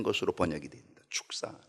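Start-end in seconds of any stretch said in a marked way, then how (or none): 1.1-1.6 hushed reveal of the word; whispered answer. Acted. Repeats the key word.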